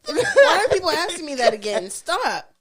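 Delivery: high, laughing exasperation; exasperated. Retold or imitated. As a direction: whine it, fed up and laughing. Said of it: "I'm like".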